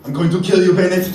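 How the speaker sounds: loud, high angry yell; chained hero shouting a threat at his enemy; furious shout. Acted. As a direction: roar the threat at him. Captive threatens villain.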